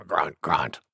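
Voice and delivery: low, soft boar grunts; grunting wild boar. A narrator's reading. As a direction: grunt the words deep down. Boar grunts after sneezing.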